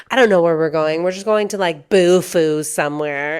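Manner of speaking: loud imitation of a friend; loudly mimicking a friend; loud mock quote. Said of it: "she described it as".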